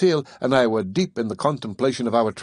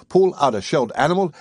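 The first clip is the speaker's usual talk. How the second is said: ordinary delivery, nothing marked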